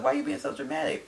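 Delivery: quick, high-pitched mock complaint; retold exasperation. Retold reaction to his outburst.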